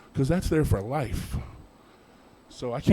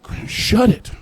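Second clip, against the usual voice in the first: mock snap; 9 semitones higher, 7 dB louder, slower than usual. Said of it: "I'm like".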